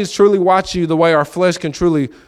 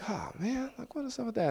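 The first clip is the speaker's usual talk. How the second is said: much softer, high, let-down muttering; deflated mutter; 8 semitones higher, 18 dB quieter than usual. Retold or imitated. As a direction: grumble under your breath, let down.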